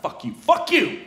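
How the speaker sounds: high-pitched indignant retort; defiant outburst. Acted. He silently answers people warning him about Nikita, indignant outburst. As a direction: fire back in comic outrage.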